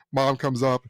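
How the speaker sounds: usual speaking voice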